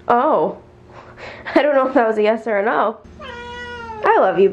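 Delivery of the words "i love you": much higher, slow, drawn-out coo; high slow pet-talk I love you; cooing to cat. Real, not acted. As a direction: melt over the cat, stretch each word.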